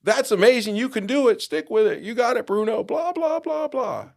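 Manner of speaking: cheering online fans. Retold or imitated. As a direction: hype him up like a chorus of cheerleaders. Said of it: "everybody's responding to me".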